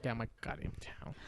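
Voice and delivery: whispered menace, barely voiced; mock whispered threat. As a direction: breathe the threat like a grumpy mayor. Mayor voice threatens kids, retold.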